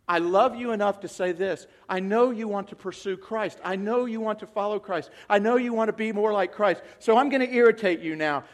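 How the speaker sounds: normal talking voice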